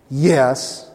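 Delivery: a loaded, meaningful yes; knowing yes. Shown as a demonstration. Preacher demonstrates the reference yes, knowing. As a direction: repeat the yes with all the hidden meaning. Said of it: "You hear the inflection?".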